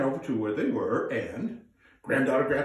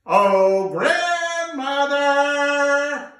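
much louder, high, very slow drawn-out call; 12 semitones higher, 9 dB louder, much slower than usual. Acted. Grandfather calling his deaf old wife. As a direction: bellow it to someone hard of hearing. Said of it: "You gotta say it with me".

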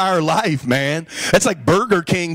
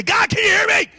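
desperate call; 14 semitones higher than usual. Acted. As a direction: shout up to heaven to be heard. Calling to God, rising.

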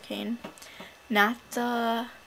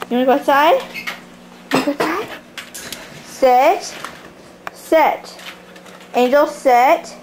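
talking to dog; 3 semitones higher, 10 dB louder than usual. Real, not acted.

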